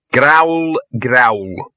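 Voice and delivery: a dog saying growl with heavy sarcasm; sarcastic growl. Acted. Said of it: "in what Victor at least recognised as a sarcastic way".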